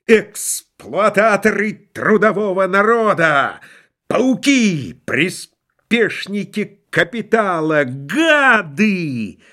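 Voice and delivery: high, drawn-out theatrical cries; hammed-up, strained hysterical denunciation; hysterical shouting. A narrator's reading. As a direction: hurl each insult in mock hysteria. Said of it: "истерически выкрикивал".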